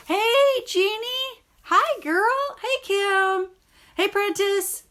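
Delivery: high, loud happy hellos; excited greetings. Real, not acted. Greeting viewers Jeannie, Kim and Prentice, loud and delighted. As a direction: call out to each friend brightly.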